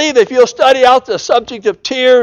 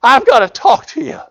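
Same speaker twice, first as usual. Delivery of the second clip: put-on higher, urgent young son's plea; pleading son; 6 semitones higher than usual. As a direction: voice the broken-hearted boy. Boy confessing to his father.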